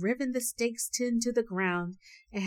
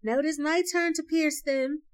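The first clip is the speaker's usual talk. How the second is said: high, raised announcement; raised storybook cry; 8 semitones higher, 4 dB louder than usual. A narrator's reading. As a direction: call out eagerly that it's your go. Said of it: "took it and cried".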